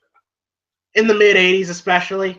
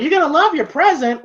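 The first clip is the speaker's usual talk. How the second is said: put-on excited kid voice; 9 semitones higher than usual. Voicing excited Rocky Junior.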